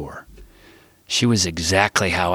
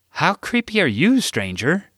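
high, sassy comeback; snapped back; 11 semitones higher than usual. Acted. Daughter snaps back at a creepy stranger, sassy. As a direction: throw his question straight back at him. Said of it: "she snapped back".

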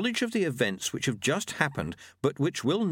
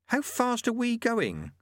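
put-on young apprentice; 12 semitones higher, slower than usual. A narrator's reading. Lobsang asks.

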